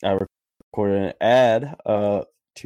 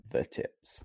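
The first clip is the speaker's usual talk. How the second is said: whispering; 9 dB quieter than usual. Real, not acted. Whispered joke reply.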